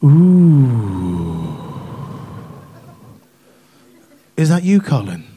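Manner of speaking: long, low, far-carrying "ooh"; put-on suggestive woman on the phone; imitated caller's voice. Woman from work rings Colin late at night, hammed-up low come-on. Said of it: "This voice goes".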